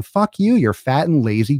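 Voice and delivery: sudden loud curse; angry outburst. Real, not acted. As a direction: spit it out at them. Ranting at lazy viewers.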